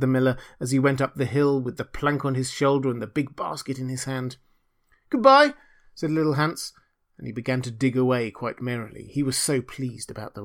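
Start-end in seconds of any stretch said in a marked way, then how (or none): 5.1-5.5 high, loud, chirpy farewell. Acted. Hans calling goodbye to the miller, cheerful. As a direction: call it out brightly.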